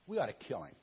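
muttered plot. Shown as a demonstration. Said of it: "Now, that's kinda harsh".

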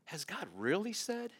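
softly voiced sly serpent; lightly voiced cunning serpent; a hint of a scheming serpent's doubt; serpent's question. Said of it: "And he said to the woman".

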